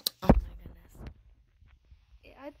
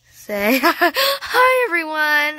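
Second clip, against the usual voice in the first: laughing greeting; 5 semitones higher, 6 dB louder, much slower than usual. Real, not acted. Greeting friends on camera, laughing.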